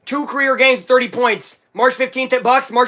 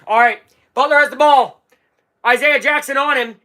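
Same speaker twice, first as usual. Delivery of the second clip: ordinary talk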